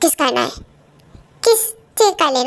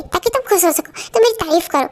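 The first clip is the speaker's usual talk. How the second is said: ordinary talk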